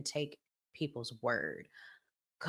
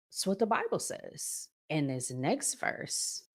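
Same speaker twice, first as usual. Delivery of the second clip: ordinary talk, nothing marked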